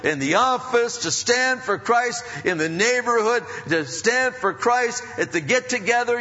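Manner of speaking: loud, high-pitched sermon delivery; full-force preaching. Real, not acted. Preacher warning the flock they'll stand alone, full-force preaching. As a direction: preach it hard, pitched well up.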